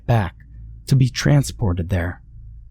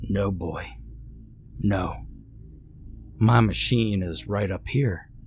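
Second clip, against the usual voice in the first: put-on quiet, level old man; a lightly voiced calm stranger; 8 dB quieter than usual.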